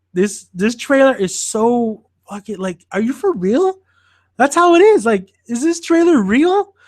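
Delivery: incredulous. Real, not acted. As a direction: voice climbing high in disbelief. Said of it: "mocking the trailer".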